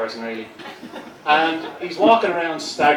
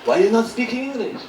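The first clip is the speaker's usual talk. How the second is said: higher-pitched attempt at a French accent; put-on French accent; 8 semitones higher than usual. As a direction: ask it in a wobbly French accent. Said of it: "I lost myself in my own accent".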